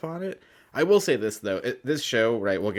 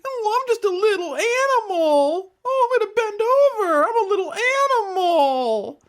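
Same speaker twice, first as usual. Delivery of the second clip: little animal voice; 7 dB louder than usual. Pretend. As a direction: play a silly tiny animal at full volume. Putting on a creature voice as a bit.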